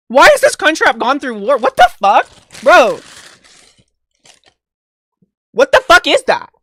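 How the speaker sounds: loud, high, exasperated disbelief; outraged yelling. Real, not acted. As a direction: yell it at the ruined food, appalled. Outraged at the bad Taco Bell order.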